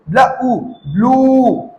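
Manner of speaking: ब्ल, ऊ apart, then long ब्लू; sounded out slowly. Shown as a demonstration. Teacher showing the same sound, slowly blended. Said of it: "यही sound generate होगा".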